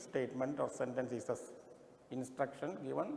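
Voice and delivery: reading the directions out word by word, quite slowly; slow reading aloud. Acted. Teacher reading exam directions to the class, slow and deliberate. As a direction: read each phrase out deliberately so students follow.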